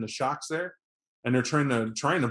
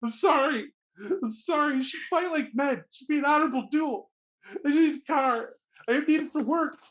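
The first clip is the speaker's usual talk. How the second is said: high, wailing, tearful begging; crying plea; 12 semitones higher than usual. Acted. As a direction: blubber it out like a beaten man. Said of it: "he'll just start crying".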